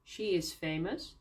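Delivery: normal talking voice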